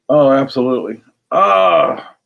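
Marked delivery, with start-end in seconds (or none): 1.3-2.1 delighted shout. Real, not acted. Exclaims at a find.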